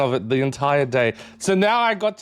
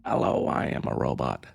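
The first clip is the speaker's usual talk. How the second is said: robot voice pushed much lower and softer; deeper robot voice; 6 dB quieter than usual. Shown as a demonstration. Retrying the robot voice for chat, much lower. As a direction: drop it down low like a machine. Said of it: "I can't do a robot voice".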